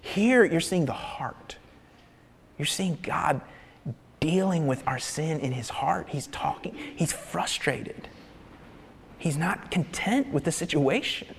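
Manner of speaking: dropping to a near whisper; hushed awe. Real, not acted. Preacher hushing the congregation, near whisper. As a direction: hush it, draw them in close. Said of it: "preacher hushes on God's heart".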